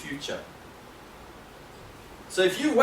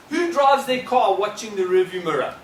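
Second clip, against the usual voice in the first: usual speaking voice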